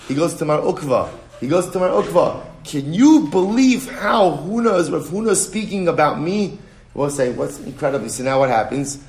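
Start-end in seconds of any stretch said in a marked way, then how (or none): 2.6-6.6 indignant complaint. Retold or imitated. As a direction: plead your outrage. Rav Anan complains to Mar Ukva.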